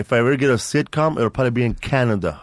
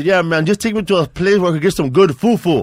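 put-on light Nigerian accent, louder and higher; voicing the Nigerian cab driver; 6 semitones higher, 4 dB louder than usual. Cab driver asking for fufu, light Nigerian accent. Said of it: "he goes".

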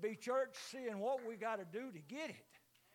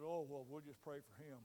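lightly voiced meek pastor, much lower and softer; mock pious pastor; 7 semitones lower, 10 dB quieter than usual. Pastor shrugging off the insult, meek mock voice. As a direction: imitate the pastor brushing it off gently. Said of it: "He said".

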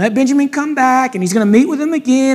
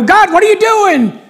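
high, pleading protest to God; exasperated complaint; 9 semitones higher, 4 dB louder than usual. Acted. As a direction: throw it upward in acted exasperation. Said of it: "we look at God and go".

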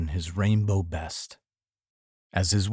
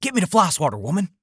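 put-on a higher barked order to his wife, the grumpy old husband; gruff husband voice; 9 semitones higher, much faster than usual.

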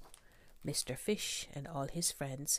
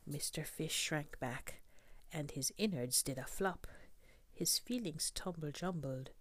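ordinary delivery, nothing marked

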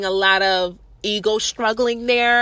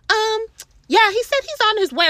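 mock manager voice; 11 semitones higher than usual. Mocking the manager's report.

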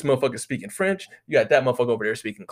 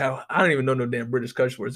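usual speaking voice

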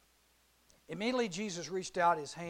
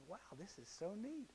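hushed wonder; 16 dB quieter than usual. Acted. Peter walking on water.

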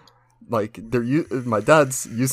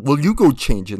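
lightly imitated dad, higher and louder; dismissive dad retort; 4 semitones higher, 7 dB louder than usual. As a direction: toss it back as an annoyed father. Dad's retort acted in story.

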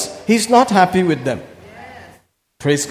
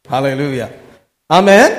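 ordinary talk

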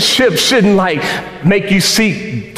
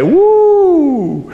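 high, bright shout of joy; excited whoop; 12 semitones higher than usual. Retold or imitated. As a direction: whoop it like you just got good news. Calling wife, excited whoop.

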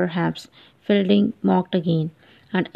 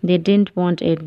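ordinary delivery, nothing marked

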